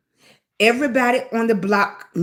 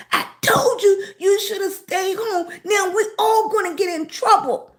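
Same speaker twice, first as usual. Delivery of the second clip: put-on scolding boy; 9 semitones higher than usual. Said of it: "David Du Bois was coming back".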